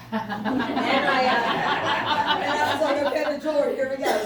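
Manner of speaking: loud laughing through the words. Real, not acted. Laughing at the wild errand.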